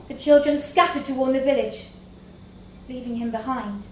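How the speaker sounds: ordinary delivery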